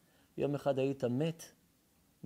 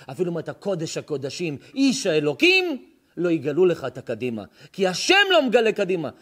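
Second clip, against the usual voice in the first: rising preacher shout; 4 semitones higher, 10 dB louder than usual. Acted. Preacher shouting at the congregation that only God knows the future. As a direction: voice climbs to a loud, high cry.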